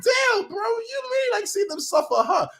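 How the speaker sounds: anguished outcry. Retold or imitated. Fan accusing the writers of cruelty, teary shout.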